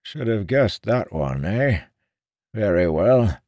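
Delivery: a lightly voiced old man; old seer voice.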